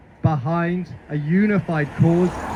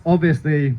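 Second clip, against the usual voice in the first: usual speaking voice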